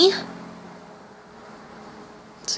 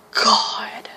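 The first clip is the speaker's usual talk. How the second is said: exasperated whisper; a real fed-up whisper; 10 dB quieter than usual. Real, not acted. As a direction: sigh it out under your breath. Exasperated aside.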